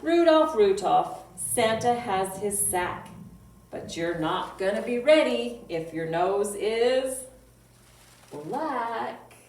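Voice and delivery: loud, sing-song poem reading; children's poem recital. Acted. Librarian reciting a reindeer poem to kids, loud sing-song. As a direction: chant the rhyme out for little listeners.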